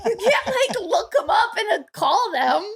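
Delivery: giggling through the words; laugh-talking, pitch shooting up. Real, not acted. Laughing at dad's overreaction.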